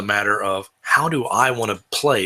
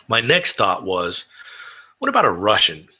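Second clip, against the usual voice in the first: ordinary delivery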